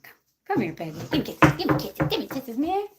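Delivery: high cooing call to the dog. Real, not acted. Calling dog, baby talk.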